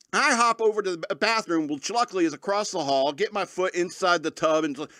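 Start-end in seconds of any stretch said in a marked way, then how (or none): none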